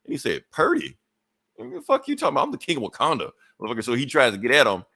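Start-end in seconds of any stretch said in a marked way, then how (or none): 0.5-3.8 high-pitched mock outrage, easing down into a boast; indignant disbelief. Hero answering a villain who mocked his suit, indignant. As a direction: echo the insult in a high, incredulous squeal. Retelling hero's reaction to an insult.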